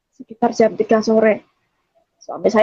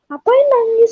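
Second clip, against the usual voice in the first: much higher-pitched question; retold protest; 12 semitones higher, 3 dB louder than usual. Niece asks her crying uncle why, in high-pitched protest.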